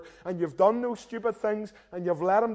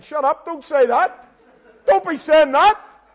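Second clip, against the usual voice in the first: higher, louder frantic self-scolding; inner panic; 9 semitones higher, 12 dB louder than usual. Retold or imitated. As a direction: yell at yourself to stop.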